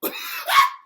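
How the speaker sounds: loud, very high burst of laughter; explosive laugh. Real, not acted. Laughing at the talk of Devon's daughter, high explosive laugh. Cracks up at own retold story.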